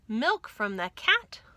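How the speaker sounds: ordinary delivery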